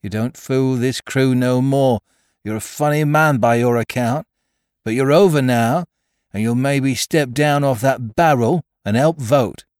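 impatient mutineer pressing his point; George. Acted. Mutineer telling Silver to step down, impatient defiance. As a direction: challenge the captain, firm and insistent.

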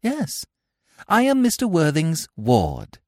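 put-on a young girl answering brightly, pleased. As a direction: chirp it out proudly. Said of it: "said Cecily brightly".

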